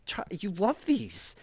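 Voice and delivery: put-on high, drawn-out plea to a child; exasperated parent pleading.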